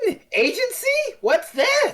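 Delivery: high, incredulous mock question; mock bafflement. Co-host mocking the lack of agency, baffled. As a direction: squeal it as if the word were unknown.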